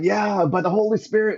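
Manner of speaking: high, beaming answer; mock eager believer; joyful reply. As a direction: answer with giddy pride. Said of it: "I'm like".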